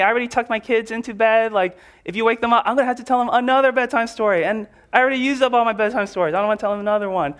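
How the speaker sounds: a put-upon neighbor whining through the door; grumpy sleepy neighbor. Pretend. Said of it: "And Calvin goes".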